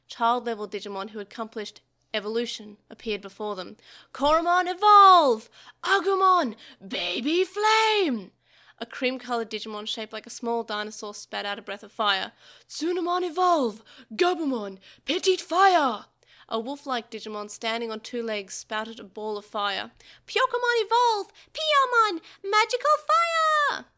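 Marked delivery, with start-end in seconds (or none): 4.1-8.3 loud, high creature shout, slow on the attack; battle cry. A narrator's reading. Digimon calling evolution and attack. 12.7-16.1 loud, high creature shout, slow on the attack; battle cry. A narrator's reading. Digimon calling evolution and attack. 20.3-23.9 loud, high creature shout, slow on the attack; battle cry. A narrator's reading. Digimon calling evolution and attack.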